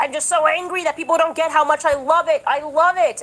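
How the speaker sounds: high, sarcastic put-on earnestness; mocking impression. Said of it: "He's like".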